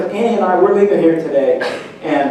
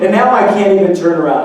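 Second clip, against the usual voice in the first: usual speaking voice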